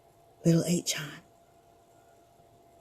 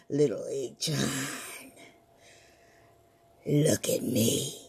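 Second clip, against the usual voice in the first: low, slow spooky call; low ghostly summons; 9 semitones lower, 8 dB quieter than usual. Acted. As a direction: lure him out of sleep, low. Ghost calls the boy.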